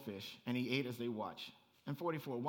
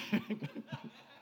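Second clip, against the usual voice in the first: airy, unvoiced chuckle; breathy laugh; 5 semitones higher than usual. Real, not acted. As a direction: laugh it off lightly. Laughs at own teasing.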